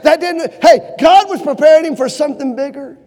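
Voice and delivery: usual speaking voice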